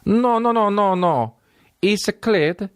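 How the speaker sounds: high, protesting Italian caricature; mock Italian accent. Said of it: "Italians go like".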